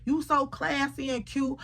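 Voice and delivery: high, gushing mock compliments; imitating a gushing flatterer; fawning friend.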